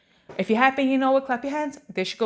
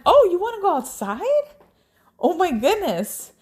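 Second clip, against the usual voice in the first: put-on high, louder gushing to a small child; excited mom; 7 semitones higher, 4 dB louder than usual. Mom retelling answer to daughter wanting outside, high excitement. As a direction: play up surprise in a high, lively voice.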